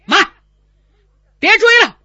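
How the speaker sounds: commanding shout. Acted. General blocks pursuit.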